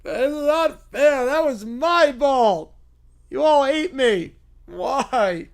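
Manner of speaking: put-on slow, plaintive childish voice; whiny kid. Fan whining to the angry crowd. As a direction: pout through the words. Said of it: "starts whining".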